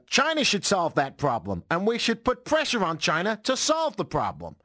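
rising, emphatic politician impression; Donald Trump. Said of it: "do the rest of the show as Trump".

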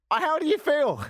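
high-pitched, squawked question; hammed-up high outburst of disbelief; incredulous question. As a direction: put it to him in a high, incredulous cry. Mock-incredulous question to the robber.

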